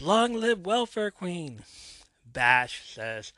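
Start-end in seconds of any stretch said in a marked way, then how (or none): 0.0-1.6 high, loud, slow fan shout from the stands; crowd yell. Retold or imitated. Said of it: "Someone in the crowd yells".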